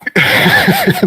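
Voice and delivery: high-pitched chuckle; amused laugh. Real, not acted. Game master to player who chose the harder route, amused high laugh. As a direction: laugh at the player's late realisation.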